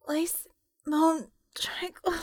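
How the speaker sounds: a shattered prisoner barely able to speak; broken moaning. Acted. Broken woman moans her name.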